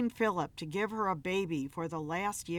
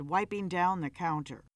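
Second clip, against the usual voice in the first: ordinary delivery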